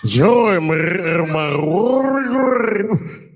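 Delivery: slow, loud, ghostly throat-rolled moan. Shown as a demonstration. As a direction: roll your throat, haunted and slow. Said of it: "you gotta roll your throat".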